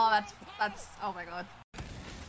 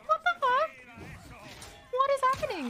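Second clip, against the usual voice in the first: surprised; 13 semitones higher, faster than usual. Real, not acted. Sudden game surprise.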